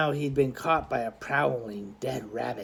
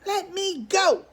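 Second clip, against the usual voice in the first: put-on squawking boy; slower than usual. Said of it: "squawked Charlie".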